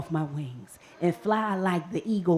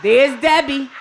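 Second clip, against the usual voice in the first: usual speaking voice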